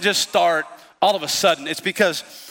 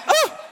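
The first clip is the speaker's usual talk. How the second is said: goat gasp. Retold or imitated. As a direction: yelp like a startled goat passing out. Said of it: "They go".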